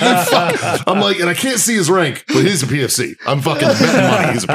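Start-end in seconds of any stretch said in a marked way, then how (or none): 0.0-0.8 higher, laughing through the insult; cursing a clumsy soldier through a laugh; laughing curse. Retold or imitated. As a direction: spit it out mid-laugh.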